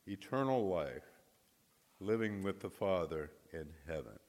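ordinary delivery

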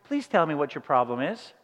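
a hint of a polite automated operator; sweet computer voice. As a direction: play a sweet phone robot. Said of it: "She's always so sweet".

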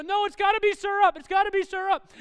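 put-on high, loud whining kid yell; mock tantrum. Kid refusing dad's toast at breakfast, mock tantrum yell. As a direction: imitate a child shouting a refusal.

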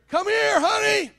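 a hint of a wife's sweet call from upstairs. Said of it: "you hear".

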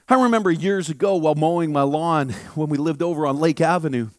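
ordinary delivery

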